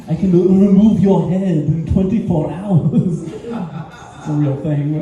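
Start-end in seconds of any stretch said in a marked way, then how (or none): 0.1-3.2 louder creepy feline voice; creepy cat voice. Acted. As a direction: purr it like a predator sizing up prey. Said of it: "It's like".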